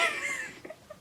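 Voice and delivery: high helpless laugh. Real, not acted. Author amused by her childhood story, laughing. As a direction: crack up at your own line.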